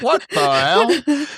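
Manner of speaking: drawn-out, high-pitched mock disbelief; incredulous face reaction. Host teasing the co-host about their face, mock incredulous. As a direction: voice a stunned face, stretched high and slow. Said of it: "your face is like".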